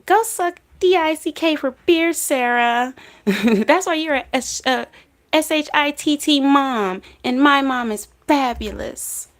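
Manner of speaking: mock taunt, pitched high; imitating combative Anna. Imitating the daughter rumbling with Sarah, high mock taunting. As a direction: hurl a high, playful insult. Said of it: "Anna is always ready to rumble with Sarah".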